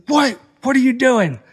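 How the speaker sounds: high, loud, sharp demand; retold screaming. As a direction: shout it in disbelief. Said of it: "screaming at me".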